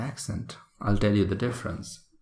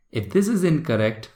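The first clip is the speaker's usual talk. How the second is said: normal talking voice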